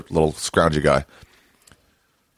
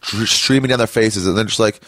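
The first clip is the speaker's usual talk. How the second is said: ordinary talk, nothing marked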